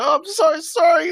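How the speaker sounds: mock wail of apology, very high and loud; spewing drunk apologising. Vomiting drunk apologising to his helpers, hammed-up wailing apology. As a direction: imitate a drunk crying out sorry mid-vomit. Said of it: "while spewing, he's like".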